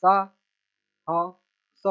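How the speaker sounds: very slow, each syllable held apart. Shown as a demonstration. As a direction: sound it out piece by piece for a learner. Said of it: "छोटा सा letter पढ़ लेना".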